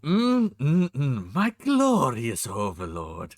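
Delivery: slow, lingering hum into a flamboyant purr of praise; Bob voice. Shown as a demonstration. Streamer showing off his voice on request, flamboyant Bob voice.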